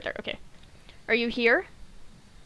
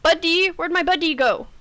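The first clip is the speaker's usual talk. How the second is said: calling out loudly for buddy; loud, higher calling out; yelling across the room; 6 semitones higher, 4 dB louder than usual. Real, not acted. Calling for missing teammate.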